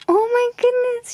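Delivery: usual speaking voice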